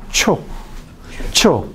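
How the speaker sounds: "cho" starting from a full closure; separate, isolated "cho" for demonstration; affricate demonstration. Shown as a demonstration. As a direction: release "cho" from a stop into friction. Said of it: "empieza con una oclusión total".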